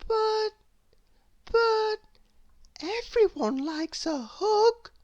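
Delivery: put-on a small girl crying out in disbelief; Little Miss Hug crying out, hurt and confused; high upset cry. A narrator's reading. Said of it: "she cried".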